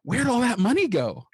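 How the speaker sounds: high-pitched imitation of a panicked wife; mock panic. Wife coming home to find the money gone, high mock panic. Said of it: "she's panicked and she's like".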